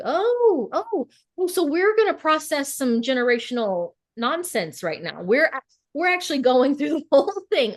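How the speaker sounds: excited inner voice. Retold or imitated. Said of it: "And I was like".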